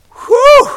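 high, loud "whew"; loud whoop. Real, not acted. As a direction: let out a high exclamation of awe. Preacher reacts to the passage.

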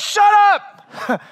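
a happy dad's hammed-up shout; father's playful shout. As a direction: cut him off like an overjoyed father. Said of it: "And the dad's like".

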